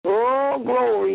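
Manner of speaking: ordinary talk, nothing marked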